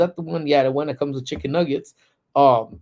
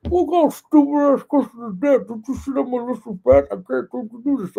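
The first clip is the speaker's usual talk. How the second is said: much higher, louder, indignant mimic of a cousin with puffed-up lips; swollen-lip outrage; 11 semitones higher, 3 dB louder than usual. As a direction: fire it back with attitude through swollen lips. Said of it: "if you're gonna cop me with the attitude".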